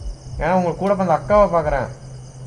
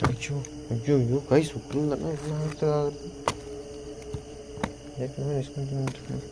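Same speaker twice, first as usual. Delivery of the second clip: soft, scared undertone; hushed and scared on a ghost hunt; hushed fear; 4 semitones lower, 9 dB quieter than usual. Real, not acted. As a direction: keep it down, something might hear. Frightened by sound upstairs.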